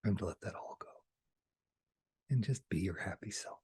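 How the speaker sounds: soft, breathy whisper. Acted. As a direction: gently release them. Soft guidance to viewers.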